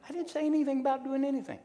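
high, fast protest of innocence; mock defensive denial. Pastor fending off abuse accusations from the web, in mock defensive protest.

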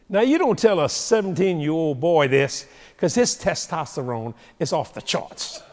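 ordinary talk, nothing marked

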